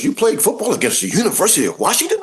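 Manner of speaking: can't believe what he hears; incredulous question. Retold or imitated.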